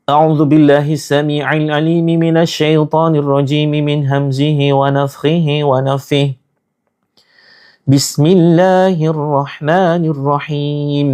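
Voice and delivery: fast, fluent Arabic chant; تلاوة سريعة; Quranic recitation. Acted. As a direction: recite the opening formula swiftly.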